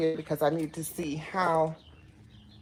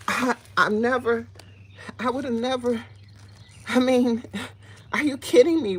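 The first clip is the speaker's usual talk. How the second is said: higher, louder, can't believe it; amazed; 6 semitones higher, 5 dB louder than usual. Real, not acted. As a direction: gush over the surprise, higher and louder. Amazed at her beets.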